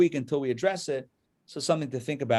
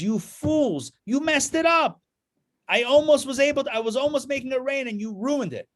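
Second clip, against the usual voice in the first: angry outburst; 10 semitones higher than usual. Acted. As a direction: scold them for ruining his trick. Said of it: "And the man is so angry".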